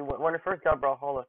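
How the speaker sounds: ordinary talk, nothing marked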